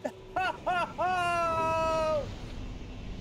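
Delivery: choked crying outburst; sobbing cry that cracks upward; real tearful sob. Real, not acted. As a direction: break into a real sob over the game. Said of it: "I'm gonna tear up".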